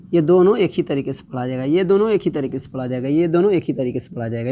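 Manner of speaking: fast sing-song drill of the rule; rapid rote repetition. Shown as a demonstration. Teacher drilling the rule into pupils at speed. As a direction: rattle it off quickly like a rote chant. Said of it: "repeated rule, rapid drill".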